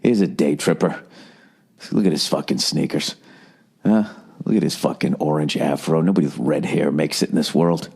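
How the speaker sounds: low, sneering murmur of snobs; snooty rich people. Retold or imitated. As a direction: look down your nose at him, voice dropped. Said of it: "they were just like".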